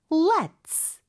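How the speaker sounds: slow "let's" with a long final s; s ending. Shown as a demonstration. Teacher modelling the ending, "let's" finishing on s. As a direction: end "let's" on a hissed s. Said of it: "스 하는 거 해주시고요".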